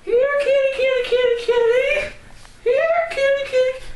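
very high sing-song call; calling a cat. Acted. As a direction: coax the kitty in a squeaky voice.

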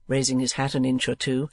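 usual speaking voice

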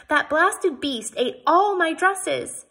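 a lady wailing over her eaten dresses; outraged complaint. Acted. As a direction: spell out the outrage word by word. Outraged lady accuses cow.

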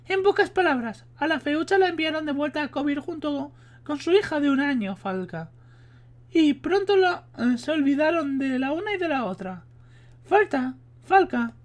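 high put-on female voice; voz de mujer. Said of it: "estoy hasta los huevos de poner la voz de mujer". (hombre imitando voz de mujer)

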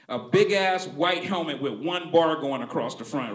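ordinary talk

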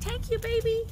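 high, loud, delighted thank-you; gushing thanks. Retold or imitated. Thanking her friend for the gift tag, high gushing squeal. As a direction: squeal it like a gift just landed. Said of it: "I was like".

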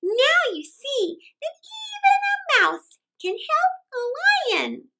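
high squeaky little mouse, proud to help; a tiny mouse reminding the lion, high and bold. Acted. Mouse reminds the freed lion of his laughter, high and proud. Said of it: "said the mouse".

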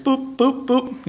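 light little "boop" beeps; imitated button taps. Shown as a demonstration. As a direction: boop like tapping buttons on a screen. Mimes tapping through the app.

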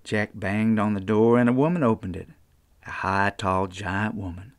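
unhurried Appalachian folk-tale telling; storytelling narration. A narrator's reading. Storyteller reading a children's tale to listeners, easy and folksy. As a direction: spin the yarn like a mountain storyteller.

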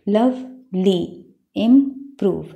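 लवली as "लव, ली", इम्प्रूव as "इम, प्रूव"; split into syllables. Shown as a demonstration. Teacher reading two syllable words to pupils, लवली and इम्प्रूव each split in two. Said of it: "दो parts हैं".

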